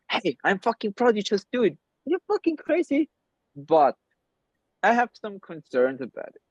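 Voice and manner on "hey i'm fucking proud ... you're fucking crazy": higher, excited imagined cheer, climbing at the end; mock cheering. Imagining friends cheering on his crazy run, excited and higher. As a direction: cheer him on, pitch rising to the last word.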